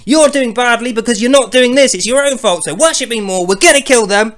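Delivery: high, loud scolding rant; mock angry god scolding his followers. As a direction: berate the faithful, fuming. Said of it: "He's, like, angry".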